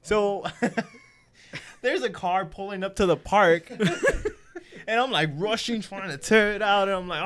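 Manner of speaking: can't keep a straight face, laugh through it; laughing while telling. Real, not acted. Telling friends the car pulled up, laughing through it. Laughing at his own story.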